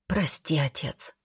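soft, hushed apology, falling away; whispered apology. A narrator's reading. Son asking his dead father's forgiveness, whispered. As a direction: murmur it very softly. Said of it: "прошептал я".

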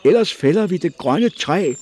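high, loud desperate cry; shouting. A narrator's reading. Hanna shouts after the prisoners, loud and high. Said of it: "råbte hun".

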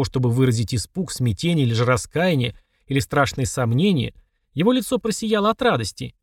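usual speaking voice

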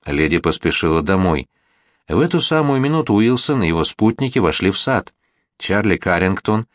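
ordinary delivery

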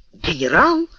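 mildly astonished outburst on one word; startled cry. A narrator's reading. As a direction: cry out in surprise at seeing your superior. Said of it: "вскричал Дигби".

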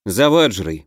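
ordinary delivery

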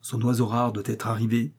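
ordinary delivery

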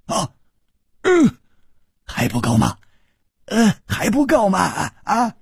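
high-pitched, stammering disbelief; startled, rising incredulous outburst; astonished. A narrator's reading. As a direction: gasp and question in shock. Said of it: "那个人吃惊地说".

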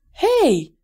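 high, sharp "hey" of protest; shouted protest. A narrator's reading. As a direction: cry out in objection, pitch jumping. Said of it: "diye bağırdı Claire". (tiz sesle itiraz edip bağırarak)

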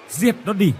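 sharp high shout, order to kill. Acted. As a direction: yell the command. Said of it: "Hiếu hét lớn".

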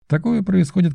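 plain reading voice

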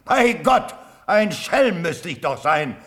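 high, loud and indignant, hurling questions; impassioned outcry. Acted. Old colonel protests to the Elector, loud and impassioned. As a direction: rise up against your lord with burning conviction. Kottwitz continues his defiant plea.

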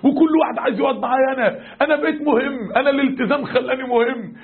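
retold excitement, high-pitched; thrilled, beside himself. Young man back from friends who now respect him, thrilled. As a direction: imitate an elated young man, voice lifted. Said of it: "الولد رجع لنا هيتجنن".

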